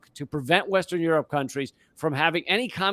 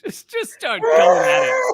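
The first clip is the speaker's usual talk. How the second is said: mock frenzy; 6 dB louder than usual. Laughing mock frenzy about chopping.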